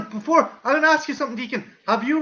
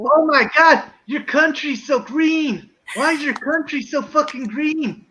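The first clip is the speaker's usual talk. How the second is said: hammed-up American tourist accent, pitched higher; 3 semitones higher, slower than usual. Doing an American accent for the guests, hammed-up American accent. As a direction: gush like an over-excited American tourist. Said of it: "you gotta do an American accent now".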